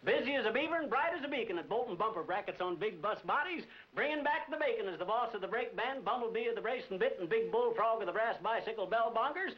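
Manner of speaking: rattled-off alliterative boast; barely moving from his own voice, a proud showy recitation; tongue-twister boast. Pretend. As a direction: show off, piling up the B words. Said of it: "would you mind repeating that?".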